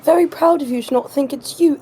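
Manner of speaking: usual speaking voice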